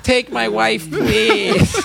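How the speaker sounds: put-on high, hammy old-time comedian; vaudeville comic. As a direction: sell it like a borscht-belt comic. Old vaudeville one-liner delivered.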